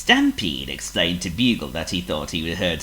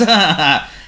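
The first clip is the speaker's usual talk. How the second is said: loud snorty cackle; gloating cackle; 12 semitones higher, 9 dB louder than usual. A narrator's reading. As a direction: cackle at the trick.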